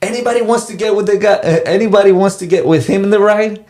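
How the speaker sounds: high-pitched mock call to a crowd; retold screaming. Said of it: "he starts screaming".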